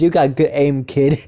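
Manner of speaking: mildly amused, mock praise; lightly amused villain. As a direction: praise them with a hint of a chuckle. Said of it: "laughs a little bit".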